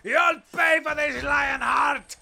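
shouting, sputtering villain; sputtering foiled villain. Acted. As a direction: spit out a foiled villain's curse. Defeated captive curses his conqueror.

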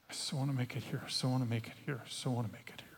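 soft, low repeated self-talk; pleading inner voice. Retold or imitated. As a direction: chant it to yourself, desperate to belong.

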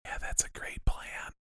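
hushed whisper. Real, not acted. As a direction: answer in a whisper. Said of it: "Why are we whispering?".

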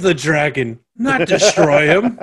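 loud, high scolding; angry yell. Retold or imitated. Voicing Tam yelling at Aes Sedai.

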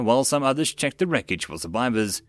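usual speaking voice

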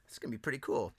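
whispered holy shit, hushed; hushed amazement. Retold or imitated. Host recalling shock at guest list, whispered. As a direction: breathe it like a shocked secret. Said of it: "I'm like".

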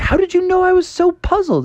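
high, puzzled little frog. Acted. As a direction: ask it wide-eyed and high. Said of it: "And Froggy's like".

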